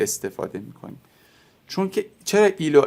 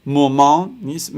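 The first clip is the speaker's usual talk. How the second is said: usual speaking voice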